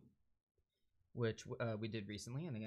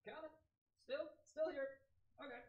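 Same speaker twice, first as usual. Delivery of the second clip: far off. Real, not acted. Calling out while away from mic.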